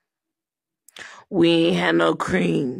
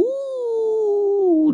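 mock ooh; 14 semitones higher than usual. Mock gasp.

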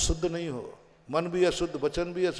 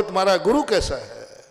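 usual speaking voice

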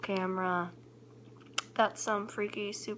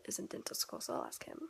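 whispering; 8 dB quieter, faster than usual. Real, not acted. Alone at home, hushed.

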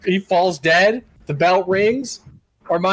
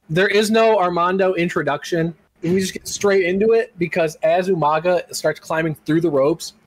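ordinary talk

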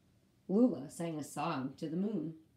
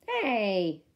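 startled hey; 7 dB louder than usual. Acted. Lula woken with a start.

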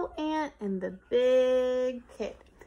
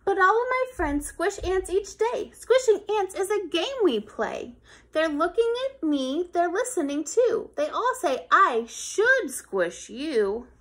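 lightly voiced pressured boy; torn kid; 5 semitones higher, 6 dB louder than usual. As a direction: feel friends watching. Kid under peer pressure.

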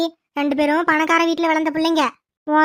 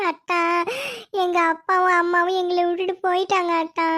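performed crying. Pretend. Niece tells aunt her parents left them, fake sobbing. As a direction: sob theatrically between the phrases. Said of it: "நம்மளும் performance போடுவோம்".

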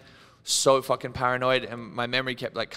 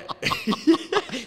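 high laugh; 4 dB louder than usual. Real, not acted. Laughs at the question.

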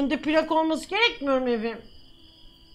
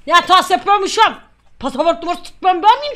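loud, raised mock-officer scolding; angry telling-off; 4 semitones higher, 8 dB louder than usual. Border officer shutting up an arguing traveller, angry telling-off. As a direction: shout the traveller down.

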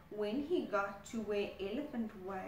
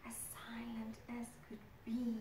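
hushed narrator whisper; 9 dB quieter than usual. A narrator's reading. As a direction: breathe it like a secret. Narrating sneaking crocodile.

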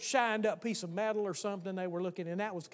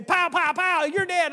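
put-on high shouted child's gunfire play; kid playing guns; 9 semitones higher, 11 dB louder, slower than usual. A boy playing cops and robbers shouts at a friend, high and loud. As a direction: yell it like a kid firing a stick gun. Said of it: "they'd say".

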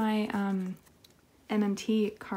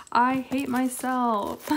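self-pity; 4 semitones higher, 5 dB louder, much slower than usual. Real, not acted. Regretting the damaged card.

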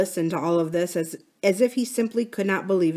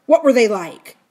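loud, raised, sharp question; 4 semitones higher, 8 dB louder than usual. A narrator's reading. Bill questions Philip about the two men, sharp. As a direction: demand a description sharply. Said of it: "he asked sharply".

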